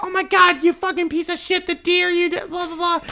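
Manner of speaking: very high mocking voice of scolding commenters; squeaky mock outrage, tailing into blah-blah; outraged followers. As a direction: mimic a pile-on of indignant messages. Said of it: "Everyone's sliding up like".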